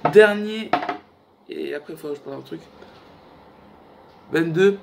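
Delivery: ordinary talk, nothing marked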